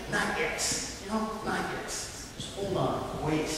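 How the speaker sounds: a quiet inner voice urging patience; soft inner voice. Retold or imitated. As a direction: hush it like a calming inner voice. Inner voice says wait.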